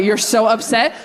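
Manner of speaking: ordinary delivery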